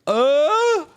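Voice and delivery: loud, high held "uh"; Dane Cook. Retold or imitated. Witness imitates his own excited reaction to the UFO, weird high noise. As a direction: hold one weird high note, loudly. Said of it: "I'm making a weird noise".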